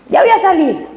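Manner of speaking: high-pitched call to mother; acted calling back. Daughter answering her waiting mother, acted calling back. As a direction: call out that you are coming, high.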